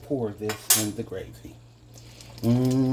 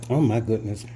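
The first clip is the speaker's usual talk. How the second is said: ordinary delivery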